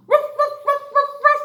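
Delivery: high yappy ruff ruff barking; playful dog barks. Pretend. Teacher barks like a dog to show the flashcard. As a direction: bark like a little puppy.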